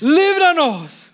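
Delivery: high, drawn-out plea; crying out to God. Acted. Preacher voicing Israel begging for deliverance, crying out. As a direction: stretch the word into a cry for rescue. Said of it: "todo lo que hicieron fue clamar a Dios".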